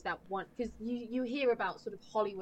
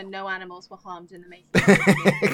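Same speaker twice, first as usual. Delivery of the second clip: laughing; 14 dB louder than usual. Retold or imitated. Quoting the film's disclaimer, laughing.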